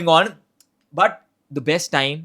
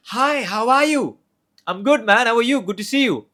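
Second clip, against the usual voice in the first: approachable friendly tone; 8 semitones higher, 5 dB louder than usual. Shown as a demonstration. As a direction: greet them like an old friend, all smiles. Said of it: "The first one, completely approachable and comforting voice is".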